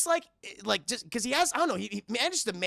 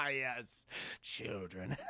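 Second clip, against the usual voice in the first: creepy low voice; 8 semitones lower, 12 dB quieter, much slower than usual. Acted. Kidnapper voice, creepy answer.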